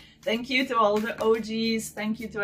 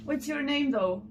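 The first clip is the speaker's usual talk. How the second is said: usual speaking voice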